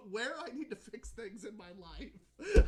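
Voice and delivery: loud, slowed words breaking into laughter; laughing. Real, not acted. Grandson tells what he needs grandma for, laughing. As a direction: lose it laughing by the end. Cracks up at brother story.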